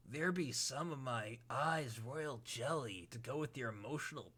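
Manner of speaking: very soft, drowsy clown voice; Gamzee voice. Acted. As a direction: mellow murmuring clown. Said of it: "how was my Gamzee".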